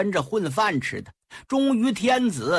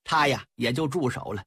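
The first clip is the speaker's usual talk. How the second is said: plain reading voice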